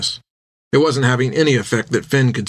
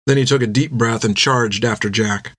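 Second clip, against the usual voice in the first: plain reading voice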